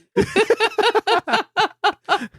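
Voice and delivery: high, long burst of laughter; laughing hard. Real, not acted. Co-host cracking up after a knock-knock joke, high laughter. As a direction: crack up at your own joke.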